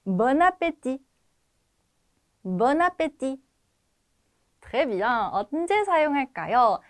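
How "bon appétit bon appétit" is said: Bon appétit slow and linked as bo-na-pé-ti; model pronunciation. Shown as a demonstration. Teacher has students listen and repeat, slow model pronunciation. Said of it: "듣고 한번 말해 볼게요".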